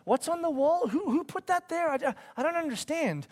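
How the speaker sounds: high, put-on bewildered voice; high-pitched mock confusion; bewildered mimicry. Mimics a bewildered household complaint.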